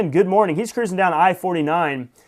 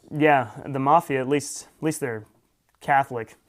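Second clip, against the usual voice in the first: usual speaking voice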